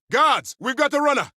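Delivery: high, loud alarm call; shouted alarm. Acted. Officer alerting guards to a runner, shouting. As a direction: yell to raise the guards. Calling guards about escapee.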